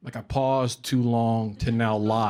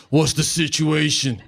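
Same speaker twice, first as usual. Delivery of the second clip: slow tough cop; slower than usual. Retold or imitated. Swat cop voiced.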